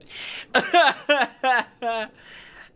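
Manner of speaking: high, loud real laughter; long laughing fit. Real, not acted. Streamer laughing at the monkey joke, loud laughing fit. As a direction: crack up loudly, high.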